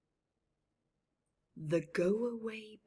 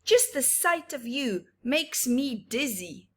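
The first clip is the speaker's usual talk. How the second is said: a hint of a cross bird, a bit louder; grumpy go-away bird; 4 semitones higher, 9 dB louder than usual. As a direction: grumble the refusal in rhyme.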